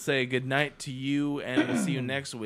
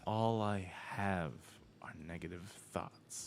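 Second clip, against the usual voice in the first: glum quote; 3 semitones lower, 9 dB quieter than usual. Retold or imitated. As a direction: drag it out like nothing matters. Quoting the therapist scene.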